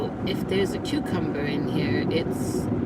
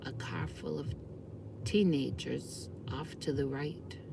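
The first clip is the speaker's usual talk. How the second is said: hushed; 2 semitones lower, 13 dB quieter than usual. Real, not acted. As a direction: keep it hushed so they won't hear. Teenagers in car beside her.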